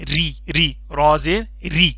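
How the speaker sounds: light, thin ra in ری, ری, را زیر ری, spelled out slowly; thin ra demo. Shown as a demonstration. Qaida teacher to pupils: thin ri, drawn out. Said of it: "پتلا کر کے پڑھنا ہے".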